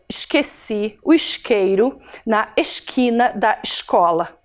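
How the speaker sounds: "Esqueci o isqueiro" with the S in "Esqueci" and "isqueiro" hissed as sh, Rio style; carioca sh accent. Shown as a demonstration. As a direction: say it like a carioca, chiando the S as sh. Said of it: "vai falar assim, ó".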